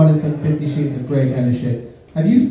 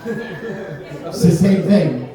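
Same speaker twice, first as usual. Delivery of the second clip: laughing; 5 semitones higher than usual. Real, not acted. Joke aside, wobbly laughing voice.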